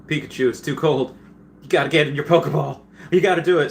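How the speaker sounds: mock-urgent Ash pleading; imitating Ash. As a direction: plead with him to get in, pushing harder. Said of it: "Ash says, like".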